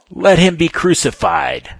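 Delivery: high, loud crowd cry; the multitude yelling at Pilate; crowd shout. Acted. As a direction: bellow it as a demand. Crowd demands crucifixion.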